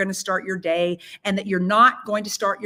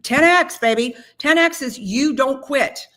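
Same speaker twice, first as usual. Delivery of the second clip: excited rallying cry; 5 semitones higher, 5 dB louder, slower than usual. Real, not acted. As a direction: shout it out to pump the team up.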